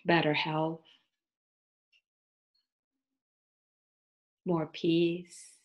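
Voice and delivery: unhurried, soothing yoga nidra guidance with long pauses; guided meditation. Acted. Teacher leading listeners into yoga nidra, guided meditation voice.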